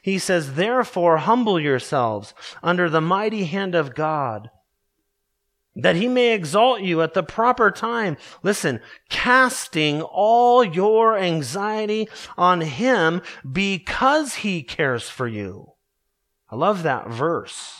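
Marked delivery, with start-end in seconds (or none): 0.5-8.2 raised, solemn reading of scripture; lifted pulpit reading voice. Acted. Reads scripture aloud. 9.1-15.7 slow, raised reading of the verse; higher, slowed pulpit reading; slow scripture reading. Acted. Reads scripture aloud.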